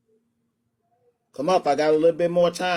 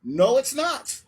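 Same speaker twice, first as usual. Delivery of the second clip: ordinary talk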